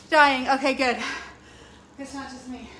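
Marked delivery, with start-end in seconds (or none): 0.1-1.0 loud, strained cry of effort; exhausted shout. Real, not acted. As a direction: force it out mid-exercise. Gasping through hard cardio set.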